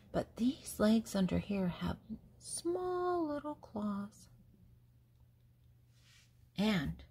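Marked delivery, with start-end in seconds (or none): 2.4-4.3 tiny voice. Acted. Describing tiny claws.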